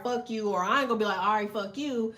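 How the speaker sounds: ordinary talk, nothing marked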